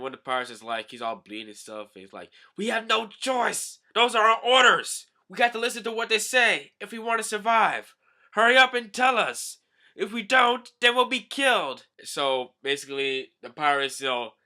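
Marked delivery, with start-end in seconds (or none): 2.6-11.9 high, loud acted plea; hammed-up panicked yelling; desperate shouting. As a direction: shout it as a pirate begging to survive. Bleeding pirate pleads for lives.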